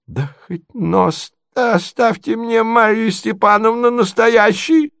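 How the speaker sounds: high, slow, plaintive plea; prince crying out. A narrator's reading. Stunned prince begs Marya for mercy, crying plaintively. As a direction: cry out in a stunned old-prince voice. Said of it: "вскричал князь".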